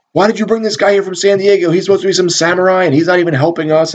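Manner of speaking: high-pitched, rapid retold tirade; mock yelling. Police captain chewing out his cops, mock yelling. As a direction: imitate a captain reaming them out. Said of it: "you know, yelling".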